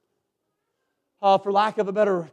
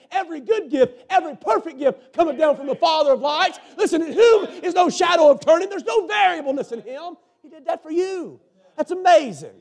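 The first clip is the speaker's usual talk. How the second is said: high, loud preacher's shout; full-force preaching; 7 semitones higher, 6 dB louder than usual. Real, not acted. Preacher crying out his own testimony to the church.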